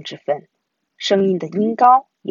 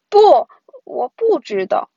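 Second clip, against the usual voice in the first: slow, higher, louder protest; uneasy protest; 6 semitones higher, 6 dB louder than usual. A narrator's reading. Brother doubting sister about ghosts, uneasy protest. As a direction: protest slowly like a scared child.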